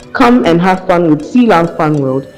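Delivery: ordinary delivery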